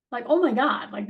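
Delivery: normal talking voice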